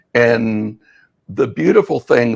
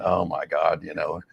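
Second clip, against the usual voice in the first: exasperated; 4 semitones lower, 7 dB quieter, faster than usual. Retold or imitated. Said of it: "shaking her head going".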